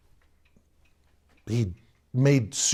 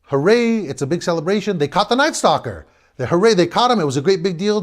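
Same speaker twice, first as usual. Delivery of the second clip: higher and louder, cheering; excited celebration; 7 semitones higher, 4 dB louder than usual. Retold or imitated. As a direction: announce the news with joy. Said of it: "Hal Fishman".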